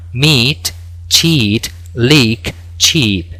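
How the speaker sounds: meat, cheat, leak, cheap with a long ee; long i words. Shown as a demonstration. Teacher listing examples for students, clear long vowel. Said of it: "se pronunciarían con I larga".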